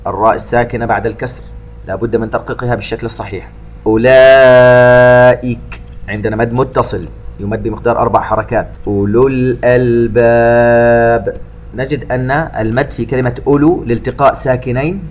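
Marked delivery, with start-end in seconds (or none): 3.9-5.8 long, drawn, loud chanted أولئك; Quran recitation. Acted. Recitation with music. 8.9-11.4 slow chanted tajweed recitation; Quran recitation. Acted.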